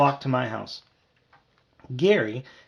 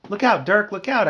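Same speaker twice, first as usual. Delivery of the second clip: high, loud squeak of alarm; raised, squeaky cry; 8 semitones higher, 7 dB louder than usual. A narrator's reading. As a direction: squeak out an urgent warning. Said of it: "I squeaked".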